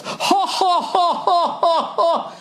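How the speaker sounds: high, slow fake laugh; high drawn-out fake ha ha ha; mock Al Gore laugh. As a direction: ham up a forced laugh. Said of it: "started laughing, just goes".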